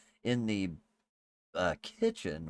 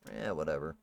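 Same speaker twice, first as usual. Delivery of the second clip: dismissive; 6 semitones lower, 4 dB quieter than usual. Acted. Cat's attitude voiced by GM.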